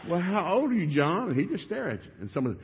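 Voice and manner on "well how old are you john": mock coaxing adult. Adults quizzing the boy.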